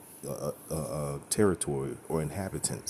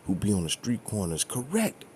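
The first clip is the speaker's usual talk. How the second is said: a hammed-up, much higher whine of disbelief; mocking news hosts; 4 semitones higher than usual. Mimicking news hosts who can't believe the street preachers were right, mock whining. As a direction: parody the pundits' exasperation, pitched way up. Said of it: "in the back of their head, like".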